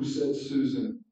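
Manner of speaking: ordinary delivery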